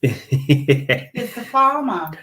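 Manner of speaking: slow, loud, hammed-up Yorkshire farmer; mock farmer voice. Teasing the reader about the farmers, mock slow loud farmer. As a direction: drawl it out like a broad farmer. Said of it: "They talking like farmers".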